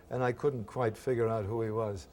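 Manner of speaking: usual speaking voice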